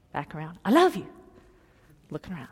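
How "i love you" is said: higher, louder swooping call; retold yell. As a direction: call up to someone riding overhead. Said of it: "he was yelling up at the carriage".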